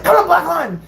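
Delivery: high-pitched instructor yell; mock holler at a recruit; imitated yelling. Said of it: "yells at me".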